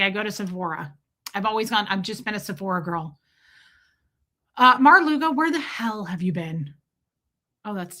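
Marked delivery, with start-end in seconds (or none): none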